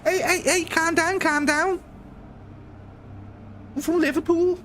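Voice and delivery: very high mock Scouse accent; hammed-up Liverpool impression, way up high; high-pitched Liverpudlian. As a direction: do a squeaky Scouser telling people to settle. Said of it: "a little bit more high pitch".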